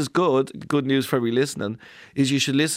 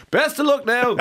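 high, breezy mock farewell; mock doctor; 12 semitones higher, 4 dB louder than usual. Mocking the surgeon sending the stent off alone, breezy and high. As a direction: toss it off like a careless send-off.

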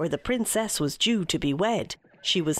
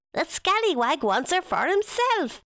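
angry fairy; 8 semitones higher than usual. Acted. Furious fairy threatening Guleesh.

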